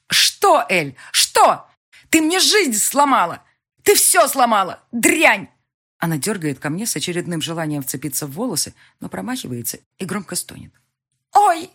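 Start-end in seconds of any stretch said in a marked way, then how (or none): 0.1-5.5 higher, louder, slower enraged accusation; furious yelling. A narrator's reading. Elvira raging at the heroine, furious. 11.3-11.7 higher, louder cry of pain. A narrator's reading. Elvira crying out in pain, sharp.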